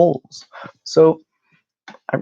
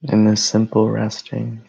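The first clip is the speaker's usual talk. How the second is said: calm, even meditation voice with long pauses; guided meditation; 2 semitones higher than usual. Real, not acted. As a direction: guide them gently into rest, phrase by phrase.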